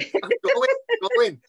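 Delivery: very high, squeaky fluster; flustered mock panic. Actor replaying the botched getaway to Andy Serkis, comically flustered.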